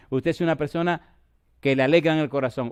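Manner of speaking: normal talking voice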